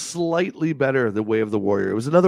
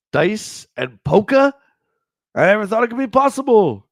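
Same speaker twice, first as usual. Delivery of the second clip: excited; 9 semitones higher, 6 dB louder than usual. Acted. Excited high reading.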